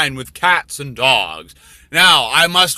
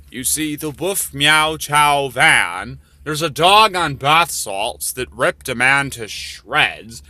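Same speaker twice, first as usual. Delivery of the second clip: ordinary delivery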